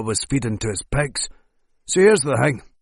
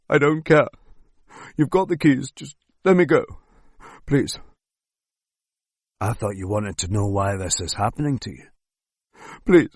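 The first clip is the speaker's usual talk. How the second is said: desperate begging. Acted. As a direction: beg for your life. Captive begging to be freed.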